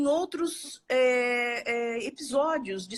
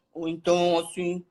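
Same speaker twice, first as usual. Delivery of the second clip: low rumbling demo voice, pitched down; too low; 5 semitones lower than usual. Shown as a demonstration. Teacher to audience, demonstrating the low extreme of pitch. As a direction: drop into the low end to show the contrast. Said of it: "maneira equilibrada de aumento do grave e agudo".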